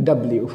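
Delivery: "ডাব্লিউ" run together as dab-liu; দেশি উচ্চারণে ডাব্লিউ. Shown as a demonstration. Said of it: "এটাকে আমরা বলি". (বাংলাদেশি ঢঙে "ডাব্লিউ")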